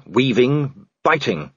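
ordinary delivery

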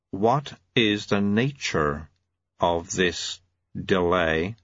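very slow, measured auditor; ponderous, deliberate official. Acted. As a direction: space the words out slowly and formally.

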